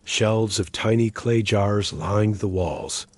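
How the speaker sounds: ordinary delivery, nothing marked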